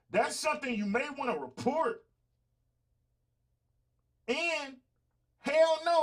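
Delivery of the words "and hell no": loud, high indignant "hell no"; loud outburst. Retold or imitated. Gun store clerk refusing a teen, loud. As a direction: bark a refusal in disbelief.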